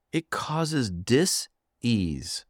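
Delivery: slow, with dis-ease cut into dis and ease; dis-ease drawn out and split in two; dis-ease split apart. Shown as a demonstration. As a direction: say dis-ease slowly, pausing between the two parts. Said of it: "That's where the word disease comes from".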